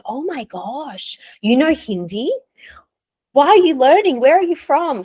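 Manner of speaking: shocked locals. Retold or imitated. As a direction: play the astonished stranger firing questions. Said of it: "so many people were shocked".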